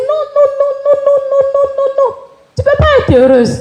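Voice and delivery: very high insistent no, repeated; mother waving her off, pitched high; mother refusing. Retold or imitated. The mother telling her daughter she cannot be happy, very high.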